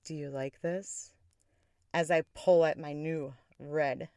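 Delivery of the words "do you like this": soft, seductive near-whisper; hushed bedroom question; retold whisper. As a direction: ask it softly, as a tease. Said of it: "I whisper".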